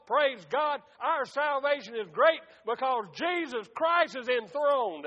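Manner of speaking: building, forceful preacher cadence; exultant preaching. Acted. Preacher praising the enthroned king, rising fervent preaching. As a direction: build steadily into a fervent proclamation.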